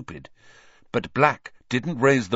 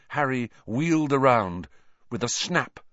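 plain reading voice

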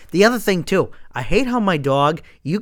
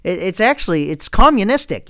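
ordinary talk

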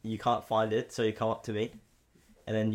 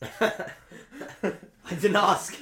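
laughing; 6 semitones higher, much slower than usual. Pretend. Laughing joke.

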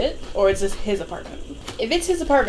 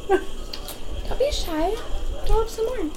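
high, soft, very slow coaxing; mock encouragement; 11 semitones higher, 4 dB quieter, slower than usual. Friend echoing the mock coaxing at the club, high and soft. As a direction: tease them sweetly to keep going.

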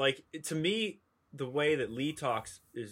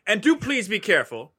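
posh quote; 9 semitones higher, 10 dB louder than usual. Retold or imitated. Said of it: "he tells the one major guy to be like".

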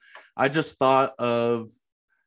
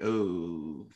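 uncomfortable oh; 6 semitones lower, 8 dB quieter than usual. Retold or imitated. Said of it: "And I was like".

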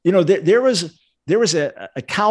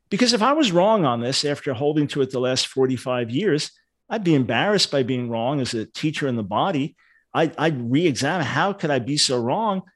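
ordinary talk

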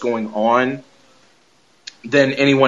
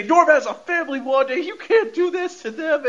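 retold yelling; 11 semitones higher than usual. Podcaster retelling Vision confronting Wanda, high mock yell. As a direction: imitate an outraged protest, pitched up high. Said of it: "they start yelling and shit".

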